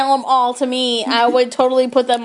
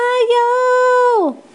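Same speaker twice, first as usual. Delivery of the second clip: excited shout; 9 semitones higher than usual. Acted. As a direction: belt it out like a cheer. Calling out the state.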